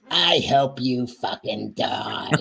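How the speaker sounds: hall monitor sneer. Retold or imitated. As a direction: drawl it out like a smug scold. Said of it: "do that thing".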